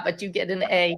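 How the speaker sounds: normal talking voice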